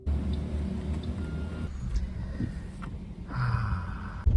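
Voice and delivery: long, quiet sigh of defeat. Real, not acted. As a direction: let out a drawn-out, dejected sigh. Despairing after the derby incident.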